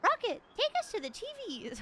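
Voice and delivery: high, eager character voice; high command. Acted. Heroine telling the ghost dog to lead. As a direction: chirp the order.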